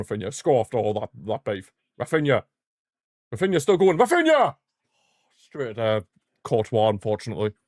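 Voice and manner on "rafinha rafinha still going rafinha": building to a high, loud yell of the name; rising excited shout. Real, not acted. Watching Rafinha run at goal, building excited shout. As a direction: build the hype to a scream.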